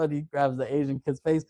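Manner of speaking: ordinary delivery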